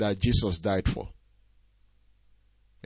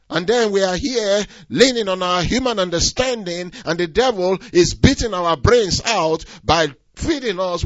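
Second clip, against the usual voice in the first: raised, higher preacher's voice; impassioned preaching; 8 semitones higher, 7 dB louder than usual. Real, not acted. Preacher urging believers to trust God's truth, impassioned. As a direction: preach it out loud and high, driving every point.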